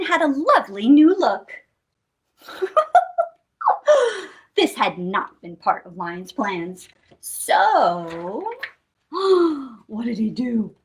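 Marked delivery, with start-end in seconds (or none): 2.6-3.2 short high-pitched titter; high giggle. Pretend. Storyteller laughs at lion's new look, high giggle. 9.1-9.6 sharp audible intake of breath; dramatic gasp. Pretend. Storyteller wonders what lion did, gasping. Suspense before lion acts.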